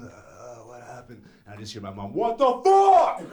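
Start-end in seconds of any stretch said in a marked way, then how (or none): 0.0-1.2 very soft, low, dazed voice; groggy hangover mumble. Acted. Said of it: "my head's ringing". 2.1-3.2 high, drawn-out mock scream; pissed-off mom. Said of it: "my mom was pissed".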